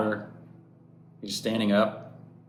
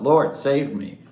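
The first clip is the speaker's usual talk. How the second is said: higher, louder desperate plea; Peter's plea, cried out louder; crying out; 5 semitones higher, 7 dB louder, slower than usual. Retold or imitated. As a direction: call out for rescue. Peter cries out while sinking.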